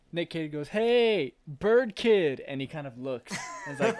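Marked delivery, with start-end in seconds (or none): 0.7-2.4 loud, higher mocking call. Nick Cage hollering at Birdie on his porch, loud call. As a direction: holler across the yard at him.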